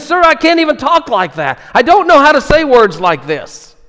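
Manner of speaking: high, loud helpless plea; exasperated thief. Acted. Thief begging the angels to stop, high and exasperated. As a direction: throw up your hands, it's beyond you.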